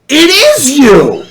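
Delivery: high, loud, drawn-out mock squeal; excited fan squeal. Woman recognizing Survivor player in hotel lobby, imitated excitement. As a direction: imitate a starstruck fan crying out slowly.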